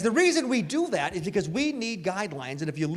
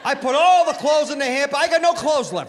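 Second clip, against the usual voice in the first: loud, high comic outburst; exasperated shout; 7 semitones higher, 6 dB louder than usual. Real, not acted. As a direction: yell it in disbelief.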